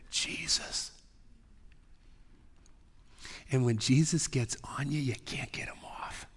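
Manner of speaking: hushed whisper to the congregation. Real, not acted. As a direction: drop to a breathy secret whisper. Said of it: "I'm doing a lot of whispering today".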